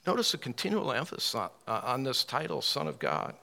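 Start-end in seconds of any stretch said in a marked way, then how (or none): none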